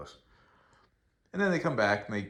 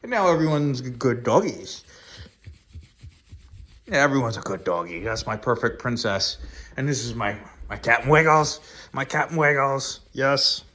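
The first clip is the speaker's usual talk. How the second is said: higher, louder sing-song praise for the dogs; doting dog talk; 4 semitones higher, 9 dB louder than usual. Real, not acted. Owner praising the dogs, high doting baby talk. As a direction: gush over the good doggies.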